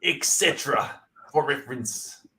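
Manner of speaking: mock Australian reading; broad put-on Australian accent. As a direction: read it as a hammed-up Australian. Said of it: "reading it in an Australian accent".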